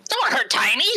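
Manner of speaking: high, loud protective outburst; Zorg. Acted. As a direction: cry out to defend a friend. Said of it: "Zorg is going to look at you, Ichni, and go".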